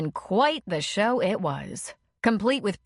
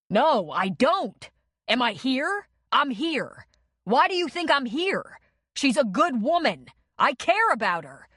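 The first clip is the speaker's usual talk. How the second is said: higher, raised angry protest; heated argument; 6 semitones higher, 3 dB louder than usual. A narrator's reading. Man defending himself in a bar fight, heated protest. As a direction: insist hotly while held back.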